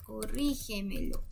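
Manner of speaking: Corrígemelo, soft, leaning on rí; stress on the rí. Shown as a demonstration. Teacher shows where the word sounds strongest, stressed rí. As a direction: quietly sound out Corrígemelo, stressing the second syllable. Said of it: "donde suena más fuerte".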